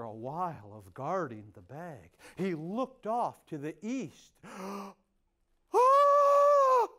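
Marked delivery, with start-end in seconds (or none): 4.4-4.9 quick startled intake of breath; surprised gasp at the dawn; sharp gasp. Acted. Coyote sees the sky brighten. 5.7-6.9 sudden high loud cry of alarm; startled shout. Acted. Coyote sees sunrise coming.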